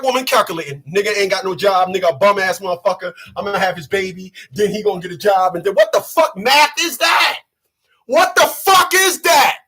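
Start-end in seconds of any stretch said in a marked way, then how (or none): 5.7-9.6 angry shout. Acted. Comedian ranting at woman's math.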